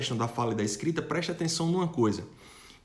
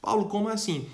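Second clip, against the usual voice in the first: mock puzzled student; 7 semitones higher than usual. Student questioning the teacher.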